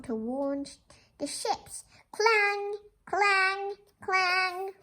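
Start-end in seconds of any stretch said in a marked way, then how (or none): 2.1-4.7 high, sung clang like a ringing bell; bell imitation. Reader imitates the fog bell for the ships, sung.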